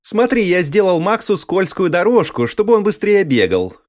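put-on child voice. A narrator's reading. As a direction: play the little boy. (детский голос)

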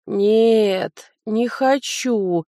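sleepy slow mumble; mumbled. A narrator's reading. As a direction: mumble it half asleep. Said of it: "промямлила я". (сонно мямлит)

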